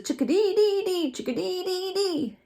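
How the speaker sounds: high laughing birdsong; chickadee call. Retold or imitated. Reader imitating the chickadee for the children, high and giggly. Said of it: "bird call imitated".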